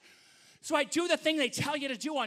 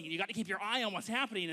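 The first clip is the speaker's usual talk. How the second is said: ordinary delivery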